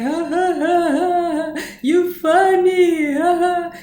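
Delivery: loud, high, sing-song forced ha ha; fake laugh. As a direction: ham up a brown-nosing laugh. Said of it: "he was laughing like".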